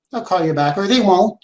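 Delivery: normal talking voice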